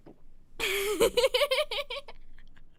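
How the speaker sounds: high, helpless giggling; real uncontrolled laugh. Real, not acted. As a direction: burst into a high laughing fit. Laughs at the awful book.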